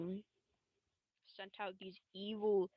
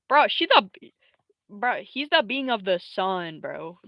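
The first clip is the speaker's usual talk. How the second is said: incredulous exclamation; 12 dB louder than usual. Real, not acted. Storyteller defending how powerful the characters are, loud incredulous exclamation. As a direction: burst out as if it's obvious.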